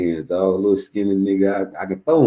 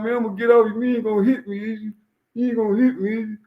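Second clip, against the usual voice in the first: high, squeaky bargaining; imitated wary pleading; faster than usual. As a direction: ask nervously in a mock kid voice. Voicing the boy wary of being hit.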